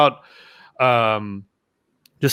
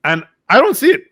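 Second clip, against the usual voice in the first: higher-pitched retold protest; mock indignant denial; 7 semitones higher than usual. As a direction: protest it in half-comic exasperation. Retells his irritated denial to friends.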